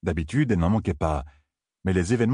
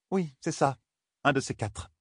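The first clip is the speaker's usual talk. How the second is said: overly polite; 8 semitones higher, 4 dB quieter than usual. Acted. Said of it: "avec un rien de courtoisie en trop".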